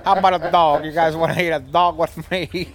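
mock Chicago guy bragging about dogs; Chicago talk impression. Mimicking John Cusack's Chicago talk for podcast friends. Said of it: "he really talks like this".

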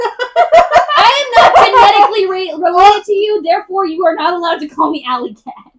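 high, loud mock outrage; mock indignation. Friend refusing a family-only nickname, mock indignant. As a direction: declare it loudly and high, in playful protest.

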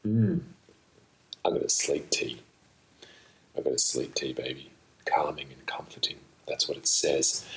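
usual speaking voice

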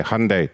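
Hyundai as HUN-deh, two short syllables; two-part Hyundai. Shown as a demonstration. Helper corrects the caller, Hyundai in two parts as hun-deh.

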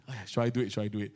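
hushed hesitation before throwing the CDs away; hesitant inner voice. Retold or imitated. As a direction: ask yourself quietly, wavering. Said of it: "I think".

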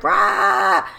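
short raw mock scream; imitated child scream. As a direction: burst out a quick "rah" like an excited kid. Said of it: "she was like".

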